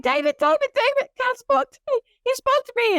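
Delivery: very high, squeaky urgent wake-up call; acted-out breathless rush to share huge news; frantic excitement. Wife waking sleeping husband after God spoke, frantic excitement. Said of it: "I'm like".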